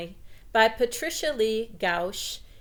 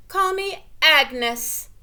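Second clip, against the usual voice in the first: defiant declaration; 7 semitones higher, 7 dB louder, much slower than usual. A narrator's reading. Girl declares new name.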